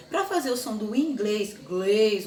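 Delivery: "inglês" with a loud, closed final E; teacher shows the closed E sound. Shown as a demonstration. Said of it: "um som fechado".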